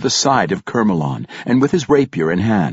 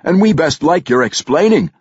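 rough driver; 10 semitones higher, 5 dB louder than usual. A narrator's reading. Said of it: "another added".

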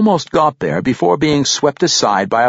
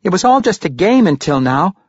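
warning; 8 semitones higher, 3 dB louder than usual. A narrator's reading. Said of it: "Regis warned".

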